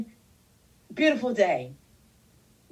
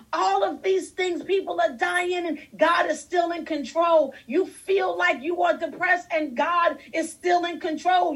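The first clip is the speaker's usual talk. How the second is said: full-force preaching; 7 semitones higher than usual. Acted. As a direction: drive the congregation with a rising, shouting cadence.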